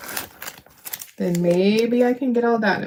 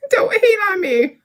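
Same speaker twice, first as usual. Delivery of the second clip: much higher, laughing through the words; laughing plea; 14 semitones higher than usual. Real, not acted. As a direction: beg them not to hate it while cracking up.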